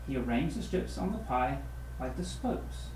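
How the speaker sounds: plain reading voice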